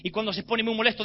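usual speaking voice

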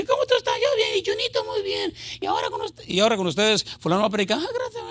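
a preacher's affected high voice, mocked; put-on preacher voice. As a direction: ham up the fake stage voice of a preacher. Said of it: "tienes que cambiar tu voz".

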